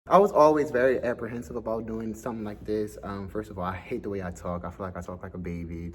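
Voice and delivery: ordinary talk, nothing marked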